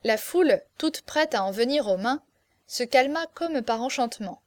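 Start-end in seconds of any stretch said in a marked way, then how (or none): none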